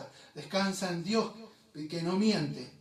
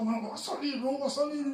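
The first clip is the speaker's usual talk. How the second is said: mock drill sergeant taunt, higher pitched; shouting officer; 7 semitones higher than usual. Soldier retelling the officer who taunted him, mock yell. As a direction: jeer it like a yelling officer at the gate. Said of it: "ese hombre gritando".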